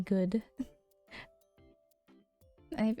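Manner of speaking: ordinary delivery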